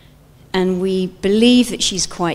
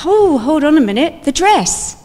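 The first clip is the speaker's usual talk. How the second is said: high, loud sudden realisation; comic panic; 9 semitones higher, 5 dB louder than usual. Pretend. Preacher imagining Mary worrying about her wedding dress. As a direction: squeal it in mock dismay. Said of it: "I'd be thinking".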